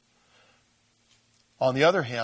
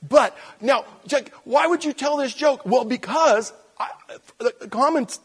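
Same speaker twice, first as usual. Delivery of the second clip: mock heckler; 12 semitones higher, 7 dB louder than usual. Preacher heckles himself aloud.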